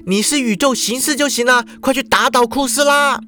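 put-on high girl's voice, firm; high, determined, stress on 就; glaring command. A narrator's reading. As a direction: order him to go fight. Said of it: "瞪着双眼叫道".

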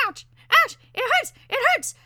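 very high, loud pained bird voice; piercing squeaky yelps; chattering parakeet. A narrator's reading. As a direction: chatter shrilly as a hurt little bird. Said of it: "chattered the parakeet".

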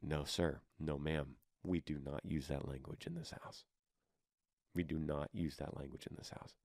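soft, low, gentle correction; grandma gently correcting a grandchild's language; gentle reminder. Pretend. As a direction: remind the child kindly but firmly. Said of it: "then you just gently remind them".

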